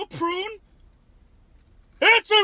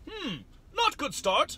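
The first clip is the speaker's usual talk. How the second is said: slow put-on voice; 9 semitones higher, 4 dB louder, much slower than usual. Odd voice trying candy.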